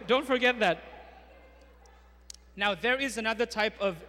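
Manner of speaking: usual speaking voice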